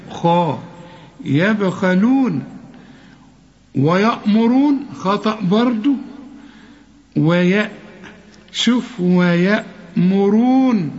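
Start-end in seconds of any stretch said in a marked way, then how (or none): 1.2-2.4 يبخلون slowly, khaa clear; slow correct reading. Shown as a demonstration. 8.5-10.9 ويأمرون slowly with a light wa; slow correct reading. Shown as a demonstration. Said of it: "ما تقولش ويأ".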